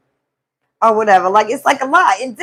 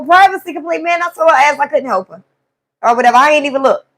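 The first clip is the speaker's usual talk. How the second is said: ordinary talk, nothing marked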